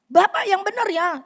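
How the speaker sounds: loud, high angry retort; angry demand. Retold or imitated. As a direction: snap at him like an angry customer. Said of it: "Marahnya luar biasa". (protes marah dan keras)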